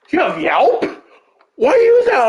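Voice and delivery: high-pitched mock disbelief; retold questioning, leaping high in disbelief; incredulous questioning. Teammates to cleric about using Yelp, incredulous.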